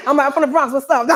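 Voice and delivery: proud Bronx intro with a laugh. Shown as a demonstration. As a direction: say it again bigger, cracking up. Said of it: "Okay, I got it".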